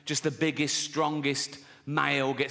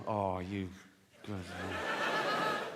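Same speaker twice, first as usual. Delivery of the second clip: fond dad; 10 semitones lower, 7 dB quieter, much slower than usual. Retold or imitated. Dad praising son.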